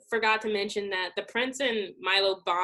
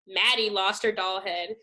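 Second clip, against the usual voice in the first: ordinary talk, nothing marked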